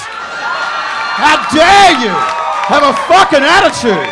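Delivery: very loud, drawn-out indignant yell; outraged shout. Acted. Comedian scolding Whitey for Latin Americans, outraged shout. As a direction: roar it in theatrical indignation.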